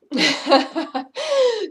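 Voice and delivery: loud, high burst of real laughter; hearty laugh that falls away; big laugh. Real, not acted. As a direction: let a full belly laugh roll out. Laughter yoga, demonstrating laughter.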